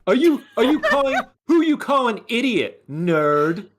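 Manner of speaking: high, giggling comeback; laughing taunt. Acted. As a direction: trade silly insults while laughing. Mock insult exchange.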